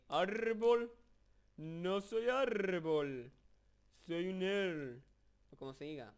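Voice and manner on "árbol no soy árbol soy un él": very high, loud and drawn-out talking tree voice; voz de Ent. Retold or imitated. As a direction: boom it out slowly like a giant tree creature from Lord of the Rings. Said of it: "Están imitando a los árboles estos que hablan". (voz de árbol parlante, aguda, fuerte y muy lenta)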